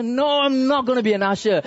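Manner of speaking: reluctant church member digging in; acted refusal. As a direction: turn the leader down emphatically. Acted refusal to leader's request.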